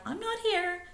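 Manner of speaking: high, quiet sneaky whisper of a lie; hushed bird fib. Acted. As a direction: squeak it out hidden like a stone. Bittern's lie quoted again.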